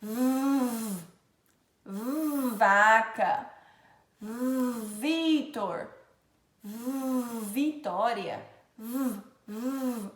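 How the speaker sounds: long buzzing "v" held before each word; vibrated V sound. Shown as a demonstration. Teacher showing a vibrating V, sustained buzz. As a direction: hum on "v", then release "vaca". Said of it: "como se fosse um som vibrado".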